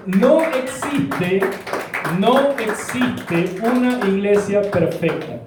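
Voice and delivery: preacher telling the congregation no perfect church exists, slowly and firmly; slow emphatic preaching. Real, not acted. Preacher drives home the point.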